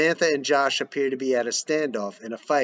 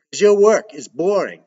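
louder, dismissive jab; loud put-down; 5 semitones higher, 9 dB louder than usual. Acted. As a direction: snap it back. Joey's cutting put-down.

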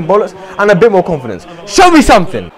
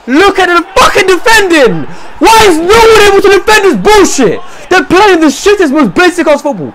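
real angry shouting, pitched way up; furious rant; 9 semitones higher than usual. Real, not acted. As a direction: rage at the team's awful defending. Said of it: "I'm so fucking angry right now".

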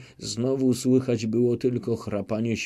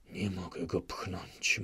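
much softer, low mutter to himself; 3 semitones lower, 11 dB quieter than usual. Acted. Chmielnicki admits he cannot stab him, muttering. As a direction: grumble it under the breath. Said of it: "mruczał".

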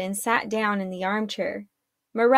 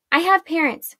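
hurried, raised-pitch correction; quick interjection; 7 semitones higher, 4 dB louder than usual. A narrator's reading. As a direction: cut in quickly, higher-pitched. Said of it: "she said quickly".

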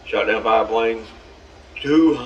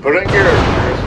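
urging; 5 semitones higher, 5 dB louder than usual. Real, not acted. Urging his ship on.

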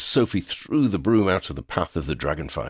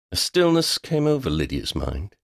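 ordinary delivery, nothing marked

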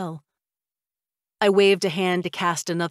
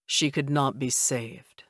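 lower, slow, heavy report; glumly; 4 semitones lower, slower than usual. Acted. Vampire husband reporting his failed case to his wife, glumly and slowly. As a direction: let the bad news sink down. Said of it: "he said glumly".

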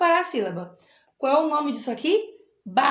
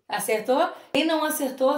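ordinary delivery

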